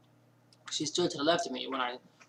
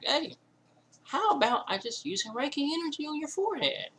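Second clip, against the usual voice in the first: much higher-pitched imitation of a friend; Butterfly Spirit; 13 semitones higher, 4 dB louder than usual. Friend offers Reiki for the headache, high imitated voice. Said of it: "Butterfly Spirit was like".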